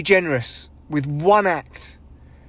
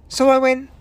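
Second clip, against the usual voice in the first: normal talking voice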